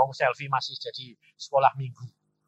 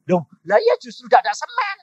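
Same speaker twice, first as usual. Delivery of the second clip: high, loud insistent reply; acted exasperation, raised voice; exasperated protest; 9 semitones higher, 8 dB louder than usual. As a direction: protest that this is the very problem. Acted exasperated reply about shortage.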